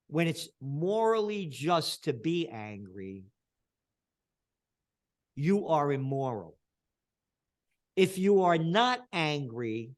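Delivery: slow, deliberate quoting; quoting Aquinas on righteous anger, slow and weighty; emphatic quote. Retold or imitated. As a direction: lay each word down slowly. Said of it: "Saint Thomas Aquinas said".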